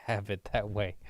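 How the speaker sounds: ordinary talk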